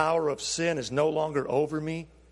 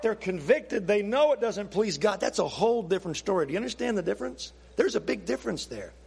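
ordinary delivery

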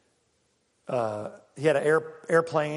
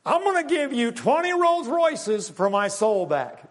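high, pushy salesman's offer; mock deal-making pitch; 7 semitones higher, 3 dB louder than usual. Sinner offering God a deal for his soul, mock high pitch. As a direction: pitch it big and high, like a bargain hunter. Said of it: "you're gonna say, "Father, I got a deal".

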